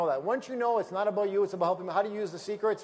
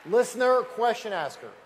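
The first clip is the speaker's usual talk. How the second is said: louder, fast, emphatic; 3 semitones higher, 6 dB louder than usual. Real, not acted. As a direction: drive the point home to the crowd. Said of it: "triumphant punchline to the room".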